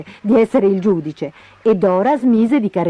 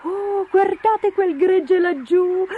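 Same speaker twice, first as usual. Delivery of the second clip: high, wailing bird excitement; giddy emu; 13 semitones higher than usual. A narrator's reading. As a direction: draw out the first cry, unable to contain yourself. Emu itches to flutter among sheep. (emù smanioso, voce acuta ed eccitata)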